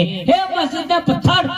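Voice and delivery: high-pitched dramatic call. Acted. Temple stone addressing the mosque stone, high dramatic call. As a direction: call across to the other one, high. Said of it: "મંદિરનો પથ્થર મસ્જિદના પથ્થરને એમ કે".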